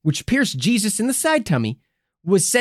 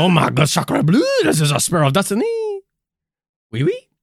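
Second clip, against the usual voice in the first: lightly mock French accent; hammed-up French exclamation; french chef. Mock Frenchman with sacre bleu.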